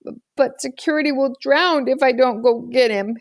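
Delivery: high, quivering on the edge of tears; a small boy about to cry; worried child pleading with his sister; tearful little boy. A narrator's reading. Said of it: "his chin was wriggling".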